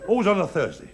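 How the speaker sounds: ordinary talk, nothing marked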